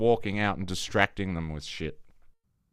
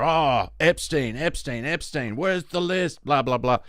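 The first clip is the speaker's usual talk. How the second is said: higher mocking imitation of an upset crowd; mock outrage; 5 semitones higher than usual. Mimicking people upset about Epstein, mock clamour. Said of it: "people were".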